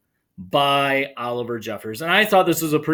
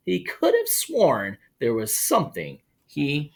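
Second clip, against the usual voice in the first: lively, higher read-aloud voice; animated storytime narration; story reading; 8 semitones higher than usual. Acted. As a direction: read it like a children's picture book. Reads picture book aloud.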